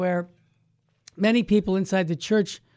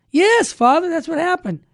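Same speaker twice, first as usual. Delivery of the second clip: high, insistent reply, loud at first; earnest, high-pitched protest; emphatic insistence; 10 semitones higher, 5 dB louder than usual. Acted. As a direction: insist it really happened, pitch jumping up. Man insists to doubting priest.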